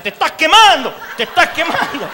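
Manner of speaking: high, loud taunting laughter-tinged yell; elder's mock exorcism shout. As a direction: tell the demon it's burning. Elder anointing with oil. (anciano gritando que se quema, medio riendo)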